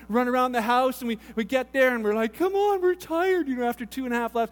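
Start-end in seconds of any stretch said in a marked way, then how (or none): 2.3-3.5 put-on high, slow whining little kid; mock childish whine; whiny kids. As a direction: drag it out like an exhausted five-year-old. Kids whining after laps.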